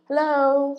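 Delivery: high, loud, put-on hello; mock uninterested clerk. Imitating the front desk clerk's blank hello. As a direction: mimic the clerk's useless greeting. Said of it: "You just responded with".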